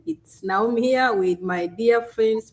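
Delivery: normal talking voice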